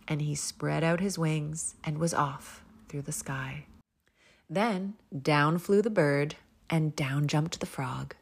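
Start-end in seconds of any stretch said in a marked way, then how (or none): none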